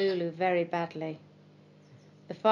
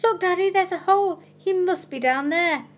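put-on excited boy; 11 semitones higher, 6 dB louder than usual. Boy finds dog's hole.

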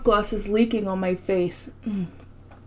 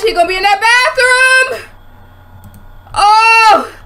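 high, raised voice against the coming scare; staying loud; 6 semitones higher than usual. Real, not acted. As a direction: wail nervously to brace for a scare. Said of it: "just stay loud so when it".

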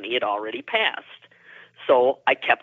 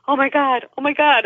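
high, louder retold outburst; worked-up exclaiming; 14 semitones higher, 5 dB louder than usual. Girlfriend exclaims after waking up screaming, high and worked up. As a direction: imitate a friend reeling from a fright. Friend just woke up screaming.

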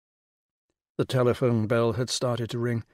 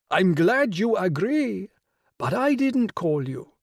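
grand regal lady, pitched high and louder; rich royal voice; 7 semitones higher, 3 dB louder, slower than usual. Acted. The Rani greeting Will on the phone, rich and regal. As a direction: sound like a queen condescending down the phone. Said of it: "the rich royal voice".

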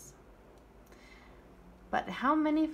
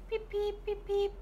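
chick peeps; 8 semitones higher, faster than usual. Pretend. Teacher voices chick for children.

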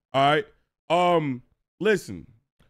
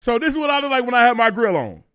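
louder and higher, half shouting; loud higher reaction while looking at the photo; loud outburst; 5 semitones higher, 9 dB louder than usual. Real, not acted. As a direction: blurt it out loud at the picture.

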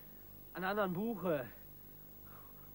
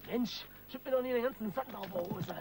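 higher, louder irritated complaint; irritated snap; 6 semitones higher, 5 dB louder than usual. Acted. Crook snapping at partner for throwing sand, irritated. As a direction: snap at your clumsy partner.

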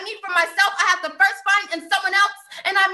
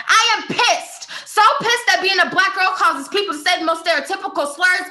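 raised, fiery slam-poem anger; angry outburst; 4 dB louder than usual. Acted. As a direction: burst out after holding back. Poem erupts after "not angry.

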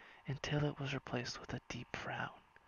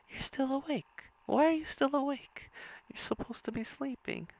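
much higher, loud surprised squeal; 11 semitones higher, 4 dB louder than usual. A narrator's reading. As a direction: blurt it out in startled surprise. Star surprised he's awake.

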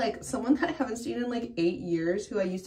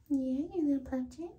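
cooing at a pet; 6 semitones higher, 8 dB quieter than usual. Real, not acted. Cooing at the kitten.

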